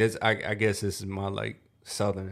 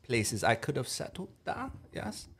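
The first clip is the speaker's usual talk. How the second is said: put-on German accent; 5 semitones higher than usual. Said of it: "let's do a German one".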